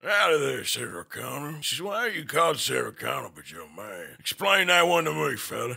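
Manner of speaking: put-on Western cowboy accent, gruff and teasing. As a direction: play the old-West cowboy mocking a name. Holds a cowboy voice all along.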